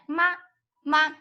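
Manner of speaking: MA short, not prolonged; a quick dot of MA at level pitch; neutral tone. Shown as a demonstration. Teacher shows not to prolong ma, short neutral tone. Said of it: "you don't pro-, pro".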